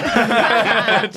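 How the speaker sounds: big high burst of laughter; loud laugh. Real, not acted. Laughing at the idea he'd back down.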